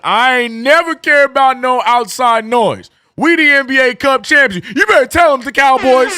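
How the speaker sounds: high, loud bragging shout; hyped boast. Real, not acted. Knicks fan gloating to the chat about the Cup, loud and high. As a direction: crow about being champions to the whole chat.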